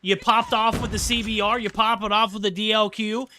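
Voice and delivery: loud, high hyped casting; hyped shout. Real, not acted. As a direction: yell it like a big play. Hyped play-by-play, manager clutch.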